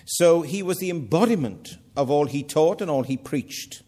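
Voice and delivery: normal talking voice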